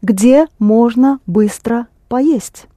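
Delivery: slow, careful teacher demo; Russian phrase spoken slowly and clearly; slow model phrase. Shown as a demonstration. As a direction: say it slowly for the learner. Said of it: "teacher models Russian phrase".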